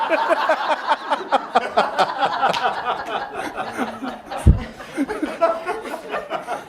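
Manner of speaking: a long laugh that climbs high; rising laugh. Real, not acted. After the heaven punchline to the audience, a long rising laugh.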